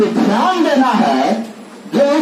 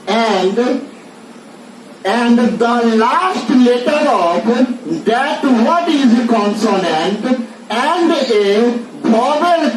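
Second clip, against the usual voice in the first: very slow, word by word dictation; much slower than usual. Shown as a demonstration. Teacher dictates the English rule for students to copy, very slowly. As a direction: read the rule slowly so students can write.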